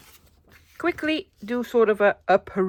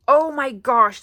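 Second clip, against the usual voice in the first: ordinary talk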